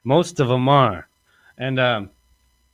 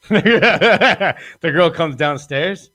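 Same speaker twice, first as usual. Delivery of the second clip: slow, higher, laughing through the words; 5 semitones higher, 4 dB louder, much slower than usual. Real, not acted. To the podcast host, laughing about the girl coming downstairs. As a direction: giggle your way through the line. Laughing while telling the story.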